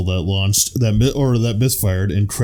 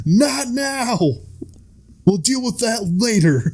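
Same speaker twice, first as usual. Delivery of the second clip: much higher, brushing it off; mock dismissive voice; 11 semitones higher, slower than usual. The country telling the government not now, in a high mock voice. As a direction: wave it away in a squeaky put-on voice.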